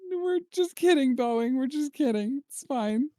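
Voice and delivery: high-pitched joking retraction; mock placating. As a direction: plead innocence in a high little voice. Mock retraction addressed to Boeing.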